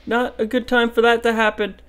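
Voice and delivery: loud, high panicked outburst; alarmed shout. Real, not acted. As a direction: yell it in sudden alarm. Danger appears suddenly.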